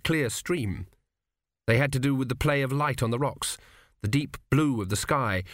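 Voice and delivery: plain reading voice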